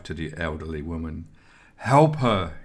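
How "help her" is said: higher, louder plea in fright; frightened cry. A narrator's reading. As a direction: cry out for help, wide-eyed with fright. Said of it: "he cried".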